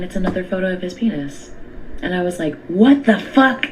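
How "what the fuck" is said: loud, high, slow disbelief; shocked. Retold or imitated. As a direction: blurt it out stunned. Retold shocked reaction to photo.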